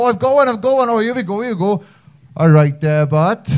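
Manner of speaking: excited, high build-up into a hammed-up Welsh accent; bad Welsh accent impression. Non-Welsh person mimics your accent at you, bad Welsh impression. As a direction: have an eager go at a terrible Welsh accent. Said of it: "if someone tries to do your accent".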